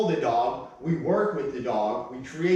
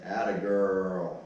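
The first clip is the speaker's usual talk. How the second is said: low, drawn-out praise; 7 semitones lower, slower than usual. Real, not acted. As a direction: let the praise linger for the dog. Praise after dog obeys.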